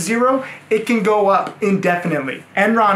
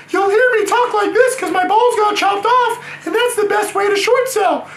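put-on castrated choir boy; 14 semitones higher than usual. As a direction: shout it up high like a choirboy whose voice never broke. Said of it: "I trade like a castrated choir boy".

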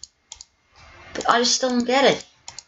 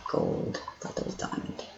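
hushed whisper; 16 dB quieter than usual. Real, not acted. Hushed reaction to found ore.

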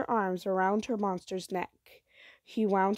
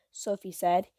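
usual speaking voice

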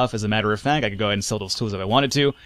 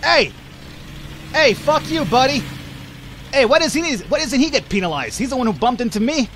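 angry yelling; 11 semitones higher than usual. Real, not acted. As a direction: yell at the other driver. Bumped by another driver.

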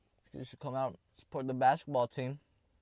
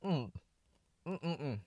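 mouth full; 4 semitones higher, 6 dB quieter than usual. Real, not acted. Eating a brownie on camera.

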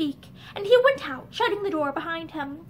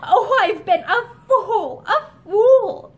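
slow, louder, laughing in despair; bitter self-reproach; 4 semitones higher, 7 dB louder, much slower than usual. A narrator's reading. Linda berating herself to Barbara, despairing laugh. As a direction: scold yourself through a broken laugh.

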